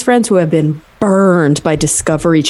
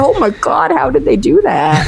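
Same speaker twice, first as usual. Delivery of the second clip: higher-pitched retold dismay; mock horror; 6 semitones higher than usual. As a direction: imitate an aghast reaction. Burned friends' reaction acted out.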